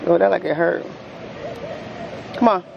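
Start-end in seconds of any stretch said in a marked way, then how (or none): none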